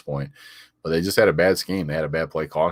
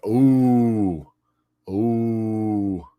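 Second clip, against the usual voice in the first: mock ooh; 5 semitones higher, 4 dB louder than usual. Real, not acted. Reacting to a burn.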